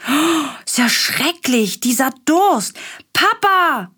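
put-on high, slow whining little boy; whiny thirsty child. A narrator's reading. Willi whining to Papa that he is thirsty, high and slow.

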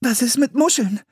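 put-on a girl still giggling over the poor eel; girl voice, laughing pity. A narrator's reading. Anne to her brother after falling in, high and amused.

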